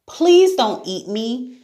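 put-on high, urgent girl's plea; acted frightened plea; pleading. A narrator's reading. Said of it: "pleaded Sarah".

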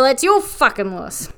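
ordinary delivery

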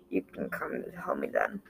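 low, whispered rasp; raspy voice. A narrator's reading. Zhang from her room, in a low rasp. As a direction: rasp it from behind the door. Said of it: "Zhang's raspy voice said".

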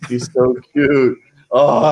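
slow, laughing pet talk; slowly fawning over the dog. Real, not acted. Guest cooing at his dog.

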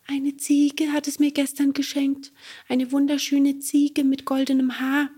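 high, light creature voice; cricket voice. Acted. Cricket tells Bengele of the golden goat, high. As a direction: tell it wistfully, small and high.

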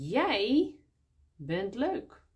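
jij pushed up high and loud; stress on jij. Shown as a demonstration. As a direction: hit the word jij hard, then bent leuk. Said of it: "Jij, de volle vorm". (jij met nadruk, hoger en luider)

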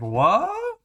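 high squeaky disbelief; a shocked viewer squeaks it out; high stunned question; baffled what. Retold or imitated. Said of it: "And you're like".

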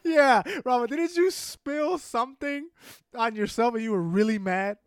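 high, loud question bubbling with laughter; amused teasing. Real, not acted. Friend teases Rafa about a theater spill, laughing.

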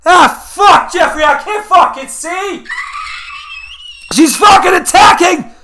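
high, loud frantic yelling; panicked shouting. Pretend. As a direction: scream as if under attack. Mock wildlife attack scene.

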